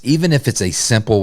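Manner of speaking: normal talking voice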